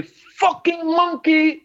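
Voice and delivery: high, slow mocking insult; mimicked slur. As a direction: drag out the slur like the fan in the stands. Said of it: "He say like this".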